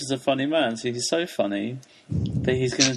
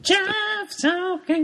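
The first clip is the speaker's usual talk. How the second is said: [name] falsetto; much slower than usual. Pretend.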